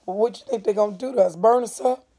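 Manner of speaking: fast, rushed and rising; Little Man in a rush; excited. Acted. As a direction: rattle it off excitedly. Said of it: "said little man excitedly".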